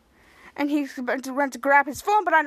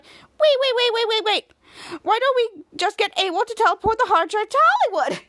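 rapid repeated waits, pitched up; urgent interruption; 7 semitones higher than usual. Retold or imitated. Shadow objecting to the plan, high urgent interruption. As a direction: cut in high to halt everyone.

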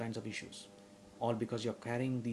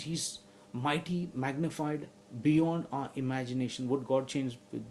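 higher, louder preaching building in force; fiery preaching; 3 semitones higher, 6 dB louder than usual. Acted. Pastor drives home God's word to the congregation, fiery and loud. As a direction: preach it with full conviction.

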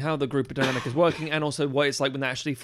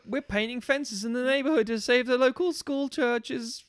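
put-on much higher, slow, goody-goody kid; eager small-town kid, drawn out; wholesome 1950s kid; 10 semitones higher, slower than usual. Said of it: "It's very like".

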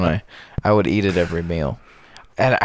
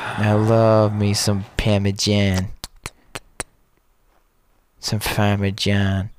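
drawn out, savoring each word; slow, relishing the food; slower than usual. Pretend. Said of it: "slow savoring food bit".

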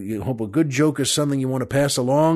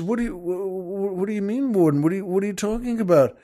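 stammering, higher-pitched confusion; baffled protest; 5 semitones higher, faster than usual. Acted. Boater questioning the game warden, baffled. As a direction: stumble over the words in mock bewilderment.

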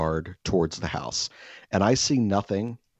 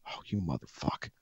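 much softer, lower grumble; under-breath curse; 5 semitones lower, 11 dB quieter than usual. Retold or imitated. Counting the jugs and finding one gone, a soft low curse. Discovers missing jug.

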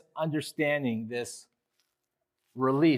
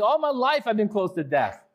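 higher, louder overblown lament; exaggerating psalmist; 7 semitones higher, 4 dB louder than usual. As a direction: pile it on like a wronged sufferer. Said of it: "He's exaggerating".

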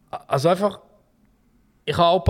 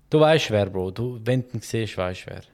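ordinary talk

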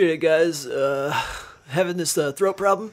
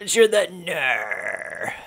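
slow drawl; 4 dB quieter, much slower than usual. Pretend. Drawn out surfer phrase.

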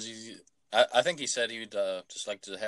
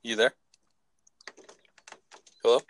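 shouting into a phone; 4 semitones higher, faster than usual. Real, not acted. Caller checks if the line dropped.

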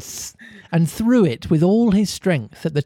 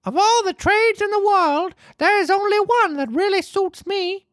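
Pinocchio voice. A narrator's reading.

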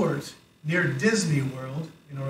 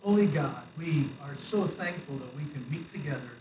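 prayer; 2 semitones lower, 5 dB quieter than usual. Real, not acted. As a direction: pray quietly and reverently for the congregation. Leads the congregation in prayer.

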